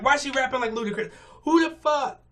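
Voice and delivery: high, loud, rapid-fire disbelief; indignant rant boiling over, pitch jumping up; exasperated outburst. Real, not acted. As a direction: burst out in fed-up indignation. Ranting about rapper's put-on sound.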